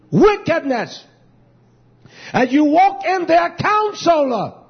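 preaching at full force. Real, not acted. Preacher pressing scripture loudly on the congregation. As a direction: drive the scripture home hard. Preacher rises again to shout.

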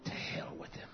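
hoarse whispered curse; whispered damnation. Real, not acted. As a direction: breathe it out, no voice.

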